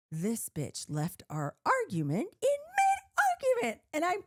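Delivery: high, slowed outraged whine; fuming at being abandoned hungry; outraged inner rant. Retold or imitated. Alone in the house after he drove off, a high outraged whine.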